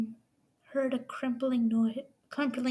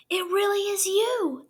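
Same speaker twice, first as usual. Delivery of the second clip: breathy gasped exclamation, higher and louder; gasped surprise; 7 semitones higher, 8 dB louder than usual. Acted. The boy gasping at Harry's portrait, amazed and breathless. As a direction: gasp it out in wonder. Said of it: "the boy gasped".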